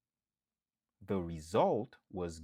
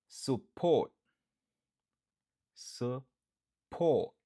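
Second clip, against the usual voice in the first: Support with port loud and high; stress on port; 3 semitones higher, 5 dB louder, much slower than usual. Shown as a demonstration. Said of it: "n ap aksantye sou dezyèm nan".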